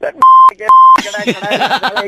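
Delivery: high, loud exasperated shout; real fed-up yelling; angry outburst. Real, not acted. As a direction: lose your patience and yell at the pest. Fed-up listener explodes at prankster.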